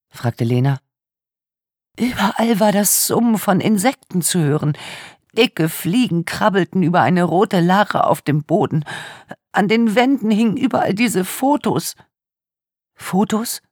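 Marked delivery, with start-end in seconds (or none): 1.9-13.6 put-on tearful young woman. A narrator's reading. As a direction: voice a traumatised young woman in tears. Tearful victim speaks.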